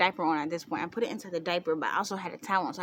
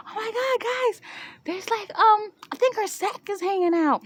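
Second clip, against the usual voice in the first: high, loud squealing report; acted alarm; 14 semitones higher, 6 dB louder than usual. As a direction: squeal it out to alert everyone. Retells alarming discovery to family.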